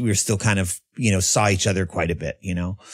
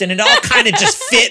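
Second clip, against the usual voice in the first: much higher, laughing through the words; 7 dB louder, faster than usual. Real, not acted. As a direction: laugh as it clicks into place. Excited about Kung Fu Panda.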